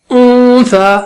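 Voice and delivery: اون سا with the n held as a long nasal hum; nasal ghunna. Shown as a demonstration. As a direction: hum اون through the nose, then release into سا. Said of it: "اس طرح سے".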